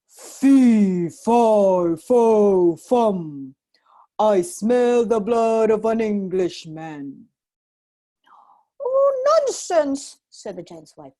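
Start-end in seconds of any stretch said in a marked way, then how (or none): 0.1-7.3 slow, loud booming giant chant; bellowing giant. A narrator's reading. Giant sniffing for Jack, loud slow bellow. 8.8-10.2 high, slow scoffing wife; giant's wife. A narrator's reading. Wife dismissing the giant, high and scoffing.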